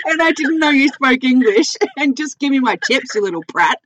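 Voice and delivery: high, loud laughing outrage; mock indignant laughing. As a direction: play up the protest through laughter. Retold indignant reply, laughing.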